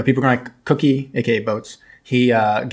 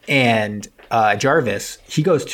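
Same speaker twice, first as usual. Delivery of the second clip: ordinary delivery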